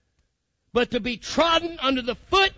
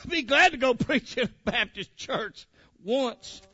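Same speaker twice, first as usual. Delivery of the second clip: slow, broken up by laughter; laughing; 4 dB quieter, slower than usual. Real, not acted. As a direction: joke through your own laughs. Joking about preaching to Baptists.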